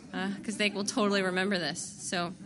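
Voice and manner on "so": ordinary talk, nothing marked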